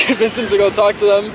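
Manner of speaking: ordinary talk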